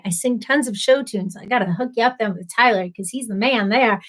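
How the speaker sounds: normal talking voice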